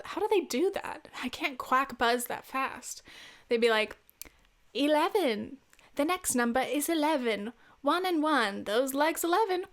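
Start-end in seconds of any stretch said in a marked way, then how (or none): 4.7-9.6 higher-pitched bingo caller in a bad British accent; terrible British accent. Podcaster imitating bingo callers calling eleven, terrible British accent. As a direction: announce it in a lilting, badly faked British accent. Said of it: "this is a terrible British accent".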